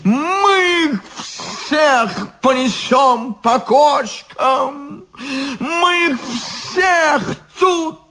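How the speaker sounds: put-on drunken shout.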